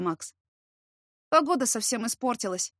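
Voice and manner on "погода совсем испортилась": ordinary delivery, nothing marked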